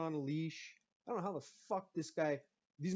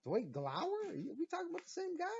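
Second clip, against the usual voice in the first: incredulous; 13 semitones higher than usual. Retold or imitated. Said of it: "I'm like".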